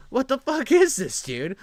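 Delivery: loud, high, disgusted outburst; outraged outburst. Real, not acted. Streamer yelling at the council clip in outrage. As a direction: blurt it out in disbelief.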